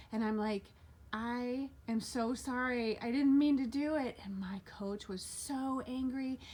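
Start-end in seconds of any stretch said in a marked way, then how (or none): 1.1-4.2 a panicked sorry that swells at the end; pleading apology. Retold or imitated. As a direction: beg forgiveness, rising into it. Retold apology after the accident.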